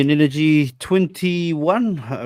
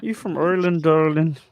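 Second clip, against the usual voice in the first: higher, lilting put-on Irish accent; hammed-up Irish accent, pitched up; mock Irish accent; 4 semitones higher than usual. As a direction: tease with a playful Irish lilt.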